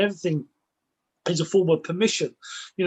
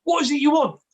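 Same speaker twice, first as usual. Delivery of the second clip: high, louder retold dream challenge; pitched-up demand to a dream monster; high challenging question; 10 semitones higher, 4 dB louder, faster than usual. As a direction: turn and face the monster, pitched up. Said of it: "turning around and saying".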